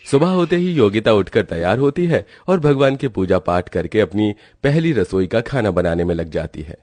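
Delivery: ordinary delivery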